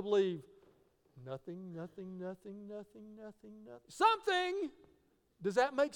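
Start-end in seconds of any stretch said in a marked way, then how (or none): 1.2-3.9 very soft, even chant of nothing; hushed repetition. Shown as a demonstration. Preacher acting out endless nothingness, hushed repetition. 3.9-4.7 high, popping exclamation of something; sudden burst. Shown as a demonstration. Preacher acting out something appearing from nothing, sudden burst.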